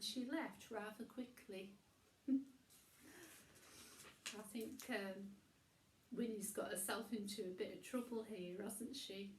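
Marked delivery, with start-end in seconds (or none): none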